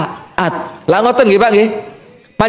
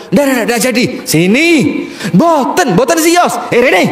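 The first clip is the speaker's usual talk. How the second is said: flustered calling back; 6 semitones higher than usual. Acted. Husband calling Umar back in a flustered laughing shout. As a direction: call him back in a panic, then laugh it off.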